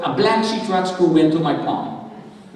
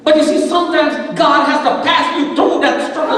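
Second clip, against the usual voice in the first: higher, louder revival preaching; fervent preaching; 6 semitones higher, 4 dB louder than usual. Real, not acted. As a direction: preach it to the congregation with full conviction.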